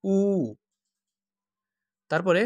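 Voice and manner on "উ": উ held long. Shown as a demonstration. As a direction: stretch উ out. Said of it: "লম্বা করে শুধু শেষ উচ্চারণটাই".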